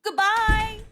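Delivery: high, bright called-out farewell; cheerful goodbye. A narrator's reading. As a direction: call goodbye while waving. Child waving goodbye.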